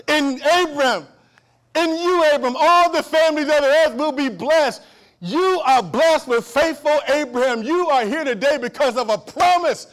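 loud, high preaching climax; preaching at full force. Acted. Preacher telling the church they are blessed, loud and high. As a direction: lift the whole room with it.

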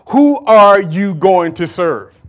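slow, pressing question to the crowd; slow challenge. Acted. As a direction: drag out each word of the challenge. Prophet challenging the people.

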